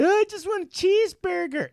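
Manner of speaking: put-on high, meek little-kid voice; mock kid voice. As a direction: plead it like a small boy. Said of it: "Then they turned to Matt and Matt".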